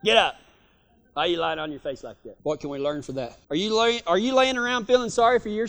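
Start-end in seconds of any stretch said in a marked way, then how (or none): 0.0-2.4 quick, quieter low nag; wife's hurried nagging. Retold or imitated. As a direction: rush him out of bed before church. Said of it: "My wife used to tell me that".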